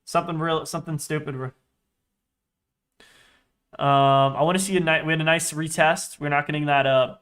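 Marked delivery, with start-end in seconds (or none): none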